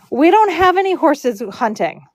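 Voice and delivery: mock call through a closed door; retold yelling through door. Homeowner answering braiders through the door, high retold call. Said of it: "yelling through the door".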